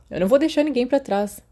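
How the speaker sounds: ordinary delivery, nothing marked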